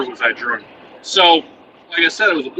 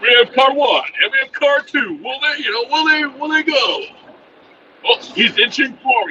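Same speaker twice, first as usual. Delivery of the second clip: higher-pitched, hyped play-by-play call of alley traffic; John Madden commentary; 9 semitones higher than usual. Retold or imitated. Drunk husband on wife's megaphone, commentating on alley traffic like a sportscaster. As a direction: call the cars like a football announcer, pitch up. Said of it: "commentating like John Madden".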